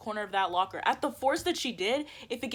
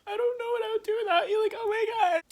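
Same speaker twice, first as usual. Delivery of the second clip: very high, whiny mock sobbing; exaggerated high-pitched weepy wail; mocking fake crying; 14 semitones higher than usual. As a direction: imitate a friend tearfully pleading, hammed-up. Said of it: "from yelling at me to crying".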